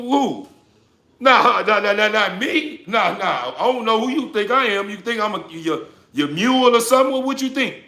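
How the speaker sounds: high, loud mock indignation; indignant refusal. Acting out a refusal to people who push him around, indignant and loud. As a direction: act out refusing to be anyone's mule.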